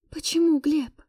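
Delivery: high, pained appeal; heartbroken question. A narrator's reading. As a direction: ask as if it tears the soul. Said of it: "спросила я о том, что просто разрывало мне душу". (с болью, высоким голосом)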